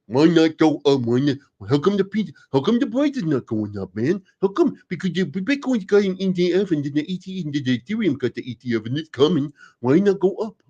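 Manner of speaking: high, whiny complaining voice; whining fan who wants the price up; mocking whiny commenter. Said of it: "the comments that have been coming at me".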